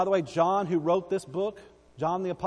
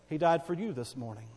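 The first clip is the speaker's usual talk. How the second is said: ordinary talk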